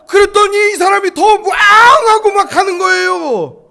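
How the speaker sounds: high, very loud, slowed-down storytelling shout; shouted chase climax. Retold or imitated. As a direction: belt out the escape with a long imitated roar. Climax of truck chase, louder roar. (절정에서 굉음까지 크게 외치며)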